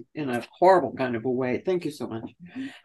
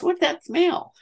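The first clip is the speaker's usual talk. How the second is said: oblivious grandmother; 13 semitones higher than usual. Retold or imitated. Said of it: "and she somehow goes".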